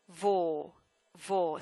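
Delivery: "vo" with the o of vogue; "vo" as a pure closed o; rounded vo syllable. Shown as a demonstration. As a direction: say "vo" low and held, like the start of vogue. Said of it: "notice how I pronounce this last, last syllable".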